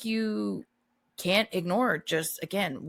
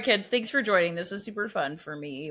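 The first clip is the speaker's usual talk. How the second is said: normal talking voice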